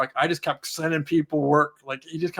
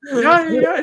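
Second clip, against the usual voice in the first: high loud eager yeahs; excited yes; 13 semitones higher, 8 dB louder, slower than usual. Retold or imitated. Speaker confirming the gift to friend, gleeful and loud.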